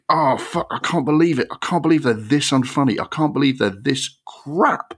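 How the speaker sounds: high-pitched cringing disbelief; watching a terrible comedy, appalled and exasperated. Retold or imitated. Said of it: "sitting there thinking".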